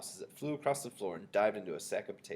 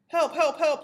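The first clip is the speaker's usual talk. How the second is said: high, loud cries for help; screaming; 10 dB louder, slower than usual. A narrator's reading. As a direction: scream in comic panic. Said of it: "the man was screaming".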